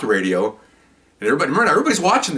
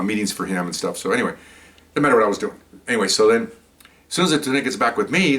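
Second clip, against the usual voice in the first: plain reading voice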